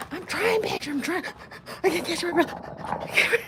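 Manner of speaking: high, loud, gasping sobs; hammed-up can't-catch-my-breath crying; acted blubbering. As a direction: sob the words, fighting for breath. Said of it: "they start crying somewhere".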